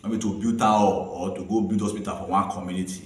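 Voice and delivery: ordinary delivery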